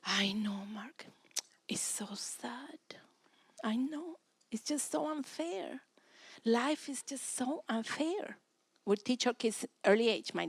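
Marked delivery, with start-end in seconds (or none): 0.0-8.4 soft, whispered sympathy, slow; a parent comforting a venting child in a whisper; soothing whisper. Retold or imitated. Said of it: "allow your child to vent".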